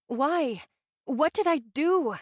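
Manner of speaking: pleading, slow and high; begging. A narrator's reading. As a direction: beg to know, near tears. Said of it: "I begged to know".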